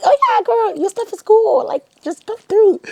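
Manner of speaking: high, loud, gushing voice of an imagined friendly director; imagined gushing reply. Pretend. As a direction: gush way up high like she loves your work. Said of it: "I would have been like".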